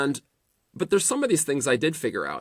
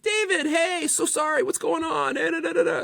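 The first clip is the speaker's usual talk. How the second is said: imitating a powerful lawyer's eager falsetto, much higher; squeaky eager greeting, pitched way up; really high voice. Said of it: "Like, this really high voice".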